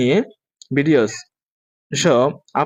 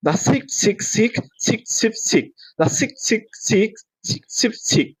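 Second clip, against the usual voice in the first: higher, run-together tongue twister; quick practice; 7 semitones higher, much slower than usual. Shown as a demonstration. Teacher demonstrates the faster drill for students, quickly. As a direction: chain the short words quickly. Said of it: "আপনারা এরকম ভাবে practice করবেন".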